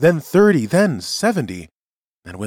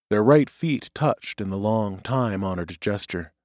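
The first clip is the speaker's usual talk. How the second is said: ordinary delivery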